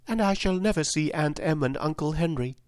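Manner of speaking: put-on high girlish voice, mildly tearful; understated near-tears little girl; Dorothy, beginning to cry. A narrator's reading. Dorothy missing her aunt and uncle, high and mildly tearful. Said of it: "beginning to cry".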